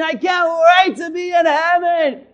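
high, loud mock whining; hammed-up complaining Martha; griping parody building then fading; mocking whiny complaint. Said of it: "That's Martha".

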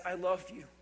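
low, soft declaration of love; voice of God. Acted. Preacher voices God's love to the listener, soft and tender. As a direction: say it gently, dropped low. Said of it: "The voice of God says".

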